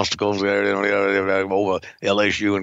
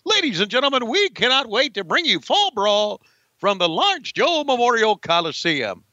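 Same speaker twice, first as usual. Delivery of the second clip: much higher hyped ring announcer; wrestling announcer voice; 9 semitones higher than usual. Acted. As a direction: ham it up like a ringside caller. Mock wrestling announcer opening.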